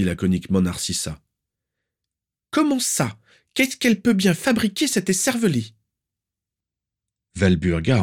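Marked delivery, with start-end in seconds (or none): 2.5-5.7 put-on very high, sharp, demanding old aunt; shrill aunt. Aunt demanding where the niece is, shrill and stern. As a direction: pinch every word with disapproval.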